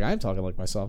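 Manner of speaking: low, soft imitation of his real voice; Gilbert Gottfried. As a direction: say it low and quiet, as just himself. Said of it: "Talk like yourself".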